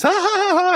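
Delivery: high, rapid mock ha-ha-ha; imitated cartoon laugh. Podcaster imitates Pops laughing at the doll, high staccato laugh. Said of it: "Pops does that whole laughing thing".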